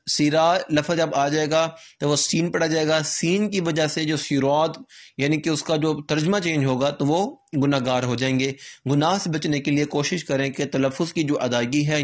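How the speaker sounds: ordinary talk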